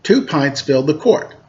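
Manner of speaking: lively read-aloud storytelling voice; storyteller reading a picture book to kids; story narration. A narrator's reading. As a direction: read it to children, bright and clear.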